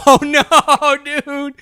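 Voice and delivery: high, loud groaning disbelief; amused dismay. Real, not acted. Co-host hearing friend's blunder with the cop, amused dismay. Reacts to the blurted denial.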